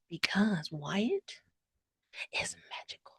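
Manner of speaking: mock secretive whisper. [name] revealing Wyatt's magic, mock whisper.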